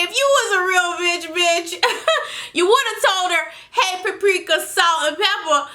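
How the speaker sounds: cracking up while quoting the line; laughing quote. Retold or imitated.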